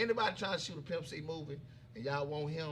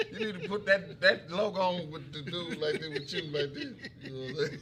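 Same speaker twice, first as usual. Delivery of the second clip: ordinary delivery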